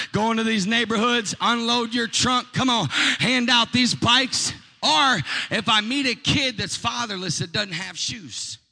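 loud, high, slow revival-preacher cadence; full-force preaching. Acted. Preacher stirring the congregation to visit the fatherless. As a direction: build it up and hammer every phrase.

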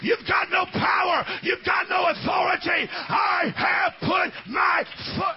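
high, loud shouted preaching over music; full-force preaching. Acted. Pastor urging the congregation to rise, shouted preaching. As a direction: preach it out to the whole church, climbing.

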